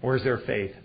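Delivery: ordinary talk, nothing marked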